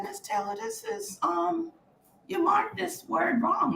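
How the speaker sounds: put-on a child politely querying the teacher, softly; timid pupil. Pupil bringing her test to Miss Taylor, soft and tentative. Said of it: "I took it up to her and I said".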